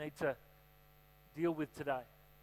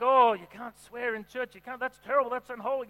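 disapproving pastor; 6 semitones higher, 7 dB louder than usual. Pretend. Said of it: "he could have been like".

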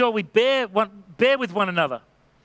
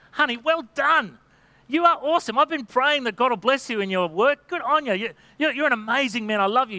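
wife cheering her husband's promotion, excited and higher; enthusiastic praise; 4 semitones higher than usual. Retold or imitated. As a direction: pour on the admiration like she's thrilled for him.

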